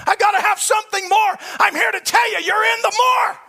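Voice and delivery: roaring revival preaching; preaching at full force. Acted. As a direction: drive each line home at the top of your voice.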